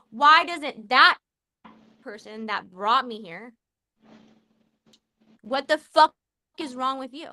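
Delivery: letting real frustration spill over; emotional outburst. Real, not acted. Guest venting about his own life, loud and emotional. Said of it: "I just got really emotional".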